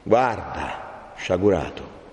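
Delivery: low, restrained reproach; grave rebuke. Acted. Friar bids wretched Renzo look around, grave. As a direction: drop the voice and command solemnly.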